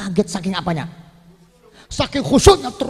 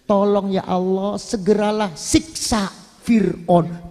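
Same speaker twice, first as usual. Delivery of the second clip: humble prayer; 5 semitones lower, 4 dB quieter than usual. Acted. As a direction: plead quietly to God. Asia's tearful supplication. (doa lirih penuh memohon)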